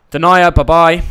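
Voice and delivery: a loud, cheeky bye-bye; loud send-off. Real, not acted. Streamer booting a defender from fantasy team, loud and cheeky. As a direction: shout the farewell at the dropped player. Dumping a player from his team.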